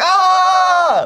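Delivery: mock moan. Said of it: "isn't he like".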